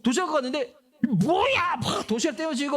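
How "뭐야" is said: high, loud mock shout of anger; mock angry outburst. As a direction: blow up like a hothead brother, half in fun. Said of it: "다윗이 열받아가지고". (열받은 척 높게 외치는 "뭐야!")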